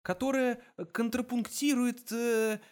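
high, slow, groping for words; stalling bluffer's hesitation; faltering. A narrator's reading. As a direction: stretch the long word, then get stuck. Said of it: "Он запнулся".

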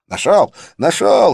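high, loud triumphant shout; triumphant yell. A narrator's reading. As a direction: crow it out in triumph. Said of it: "торжествующе завопил он".

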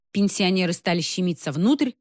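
ordinary delivery, nothing marked

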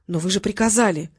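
ordinary delivery, nothing marked

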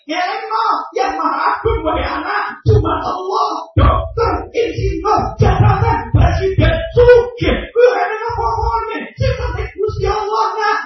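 impassioned sermon in short phrases; preaching at full force. Real, not acted. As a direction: pitch it high and drive each phrase home.

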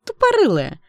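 high, loud squealed insult; sling the insult high and loud; high-pitched mean-girl taunt; jeering sidekick. A narrator's reading. Bully's friend insults the girl.

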